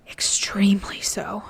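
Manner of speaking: hushed, breathless whisper. A narrator's reading. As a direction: breathe the words out softly. Whisper after "They are.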